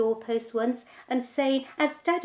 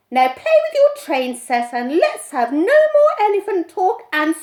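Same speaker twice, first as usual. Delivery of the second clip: much louder, threatening mother; mother scolding her son, much louder; stern scolding; 3 semitones higher, 13 dB louder than usual. A narrator's reading. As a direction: warn the boy off.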